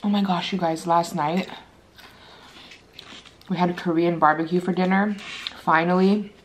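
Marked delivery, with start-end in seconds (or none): none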